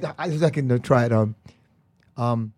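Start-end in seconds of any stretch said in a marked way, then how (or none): none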